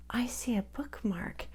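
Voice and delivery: hushed secret whisper. Real, not acted. Host whispers to kids about the bookmark. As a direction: share it like a secret.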